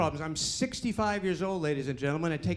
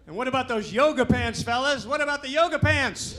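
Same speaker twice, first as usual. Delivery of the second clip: high, loud call to the fellas; rousing excitement; 7 semitones higher, 4 dB louder than usual. Pretend. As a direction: whoop it up about a favorite topic.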